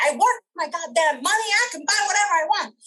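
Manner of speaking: retold burst of defiance; defiant mock anger. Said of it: "I spend it because I'm angry".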